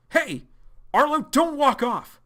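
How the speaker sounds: high, loud yelling after him, slowing on the last words; desperate shout. Acted. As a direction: shout so he can't walk away. John shouts after Arlo walking off.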